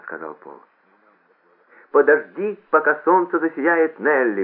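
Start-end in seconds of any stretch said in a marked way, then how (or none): 1.9-4.4 high, loud loudspeaker cry; shouted announcement. A narrator's reading. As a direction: call out the song title to a crowd. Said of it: "выкрикнул громкоговоритель".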